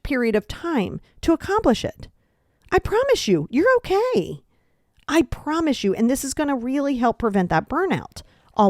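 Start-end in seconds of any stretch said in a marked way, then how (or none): 2.7-6.0 reassuring. Real, not acted. Reassuring listener.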